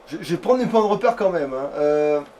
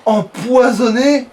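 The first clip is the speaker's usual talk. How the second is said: a drawn-out, louder, higher "Empoisonné?"; surprised question; 5 semitones higher, 8 dB louder, much slower than usual. Real, not acted. Gamer echoes his own news in slow disbelief, higher and louder.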